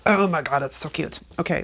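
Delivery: ordinary talk, nothing marked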